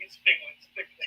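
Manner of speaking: high squeaky Trump try; badly faked Trump voice; Trump impression. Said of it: "I can't even begin to do it".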